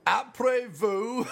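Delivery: slow, drawn-out Après vous through a laugh; mock French, laughing. Joking with a friend, slow laughing mock French. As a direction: lay on a silly French accent. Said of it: "joking about bad tourist French".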